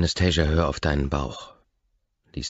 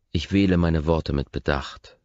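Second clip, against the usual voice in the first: ordinary delivery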